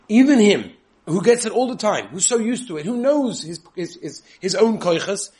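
high, loud emphatic build; emphatic rise. Real, not acted. Preacher stressing that even the great need praise, emphatic. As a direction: drive home that even he needs it.

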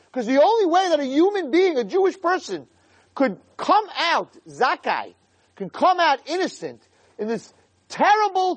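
high-pitched, fervent sermon delivery; impassioned preaching. Real, not acted. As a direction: preach it urgently, stretching the key words. Preaching at full force.

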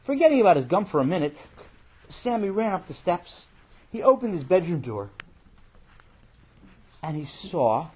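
usual speaking voice

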